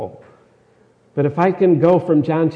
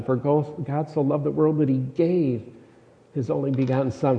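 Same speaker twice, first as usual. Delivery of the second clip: "gave" held, with a pause after it; stress on GAVE. Shown as a demonstration. Preacher re-reads the verse, stress on "gave". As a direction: lean on "gave", pause, then finish quickly. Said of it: "with the emphasis on a different word".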